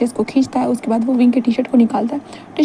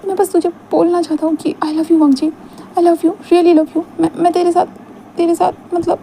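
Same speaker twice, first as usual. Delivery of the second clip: higher, voice close to breaking; tearful confession; 6 semitones higher than usual. Acted. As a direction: plead through rising tears. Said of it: "वो रोने लगता है". (रोते हुए इज़हार)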